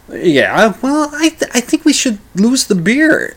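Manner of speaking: hesitant high-pitched reply; high Bob voice. Retold or imitated. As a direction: pipe up with a reluctant yes. Bob voiced in retold story.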